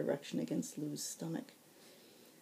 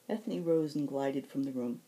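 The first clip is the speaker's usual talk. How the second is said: ordinary delivery, nothing marked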